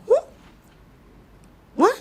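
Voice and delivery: normal talking voice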